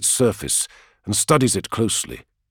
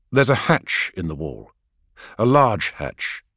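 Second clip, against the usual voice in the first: ordinary delivery, nothing marked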